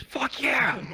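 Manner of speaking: high mock cheer; high-pitched whoop of approval, acted out; yelling from background. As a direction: imitate a hyped friend's holler. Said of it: "We all start yelling from the background".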